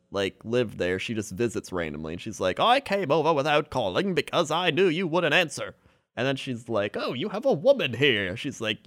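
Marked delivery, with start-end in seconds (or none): 2.6-5.7 higher-pitched, singsong mother voice; lightly voiced mother. Voices visiting mother in retold film. 7.0-8.4 mock-surprised mother, pitched up; lightly voiced mother. Voices surprised mother in retold film.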